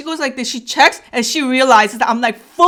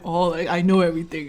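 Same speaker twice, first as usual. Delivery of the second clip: know-it-all; 6 semitones lower, 5 dB quieter than usual. Retold or imitated. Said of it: "I'm acting like".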